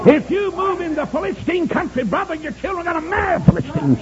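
high, loud revival preacher; high-pitched pulpit cry; full-force preaching. Acted.

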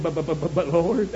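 mimicked stammer. Moses objecting to the Lord, mimicked stammer. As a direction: trip over the first word again and again. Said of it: "here old Moses said".

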